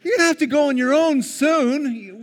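high, loud mock parent voice; hammed-up nagging parent; exasperated parent. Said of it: "And you're like".

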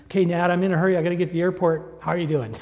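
loud, high, rushed run of words; hurried greeting. Retold or imitated. Man late for the airport greeting his neighbor, hurried. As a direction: blurt it out as if short of time. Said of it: "first thing out of my mouth has to be".

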